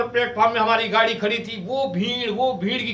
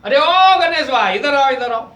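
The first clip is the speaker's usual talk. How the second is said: loud, slow, high call to a friend; 4 semitones higher, 7 dB louder, slower than usual. Retold or imitated. Father hailing his friend Ganesh from afar, loud drawn-out call. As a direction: stretch it out as if across a crowd. Calling a friend across station.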